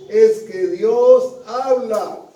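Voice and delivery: DIOS drawn out loud, then HABLA punched; slow, forceful weight on "Dios habla"; emphatic declaration. Real, not acted. Preacher explaining why discernment is hard, emphatic declaration. Preacher drives home key point.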